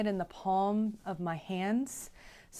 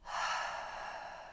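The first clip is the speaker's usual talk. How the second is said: long, soft exhale; cleansing breath out; 8 dB quieter than usual. Real, not acted. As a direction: let out a slow, settling sigh. Deep cleansing breath before channeling.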